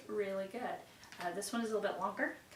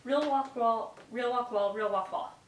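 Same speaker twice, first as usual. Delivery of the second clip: careful tongue twister demo, louder; 2 semitones higher, 7 dB louder than usual. Shown as a demonstration. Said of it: "let me try".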